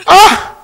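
high, sharp "ah!"; sudden high cry of despair. Acted. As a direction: burst out in anguish. Despair over the money.

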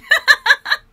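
a sudden loud, high laugh at herself; bright burst of laughter. Real, not acted. Vlogger laughing at herself about crying. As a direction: laugh it off, embarrassed but happy.

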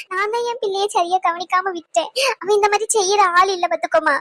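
self-blaming, breaking into sobs; crying. Acted. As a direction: call for a missing son while crying. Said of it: "அழுகாத, சொல்றதை கேளு". (அழுதுகொண்டே)

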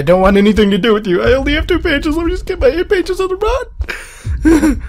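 very high, rushed panic squeal; frantic babble rising far above normal pitch; panicked pleading. Real, not acted. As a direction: plead fast, climbing into a squeak. Pleading while fleeing the game monster.

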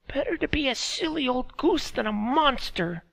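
put-on high, thin old woman's voice; old woman speaking through a laugh; old Meredy. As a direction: voice her as a frail old village woman. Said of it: "Meredy replied".

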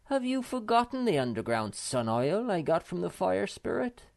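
triumphant. A narrator's reading. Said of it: "waved it triumphantly".

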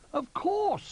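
very high, loud little bear. A narrator's reading. Little Bear assuring Old Bear, high and confident. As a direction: squeak it out with confidence. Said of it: "said Little Bear".

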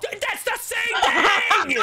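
high, slow shout through laughter; laughing shout. Real, not acted. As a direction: yell it while cracking up. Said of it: "I'm crying".

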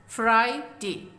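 फ्राइडे slowly split as फ्राइ, डे; syllable by syllable. Shown as a demonstration. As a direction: say फ्राइडे in two slow beats. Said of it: "कैसे divide किया गया". (फ्राइडे को धीरे, दो हिस्सों में बोलें)

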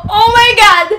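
high, loud tearful shriek; screaming, about to cry; near tears. Real, not acted. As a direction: wail it in overjoyed disbelief. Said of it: "Naiiyak talaga ako".

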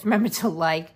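laughing through hiccups; hiccuping. Real, not acted. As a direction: laugh and hiccup while speaking. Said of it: "I got the hiccups".

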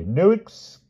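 slow, mock-grave decree; a touch of pompous solemnity; solemnly. A narrator's reading. Palomides solemnly muddling a word, slow. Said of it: "said Sir Palomides solemnly".

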